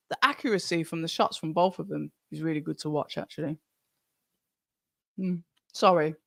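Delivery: talking through a mouthful; crunching popcorn while speaking; munching popcorn. Real, not acted. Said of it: "I'm eating popcorn".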